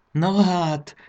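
grandma amazed to find the bed made, astonished. Acted. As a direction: gasp it in happy disbelief. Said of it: "mondta elképedve a nagymama".

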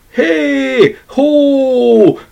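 high, ringing sleigh call. A narrator's reading. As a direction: shout it to the star horses racing ahead. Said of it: "kiáltotta a csillaglovainak".

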